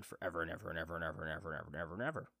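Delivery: fast, low running repetition; rapid-fire rambling. Real, not acted. Fan wishing the show never ended, rapid-fire. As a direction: rattle it off without a gap.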